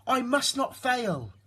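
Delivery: high, firm little snail; determined snail. A narrator's reading. Tiny snail vows not to fail, high and firm. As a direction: steel herself, small but brave. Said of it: "said the tiny snail".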